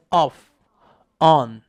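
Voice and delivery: "off" and "on" with open "ah" vowels; correct vowels. Shown as a demonstration. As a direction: model "off", "on" as "ahff", "ahn". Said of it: "똑같아요". ("off", "on"을 입을 열어 '아' 소리로 발음)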